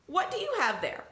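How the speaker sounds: tag curious donkey. Acted. Donkey character in story.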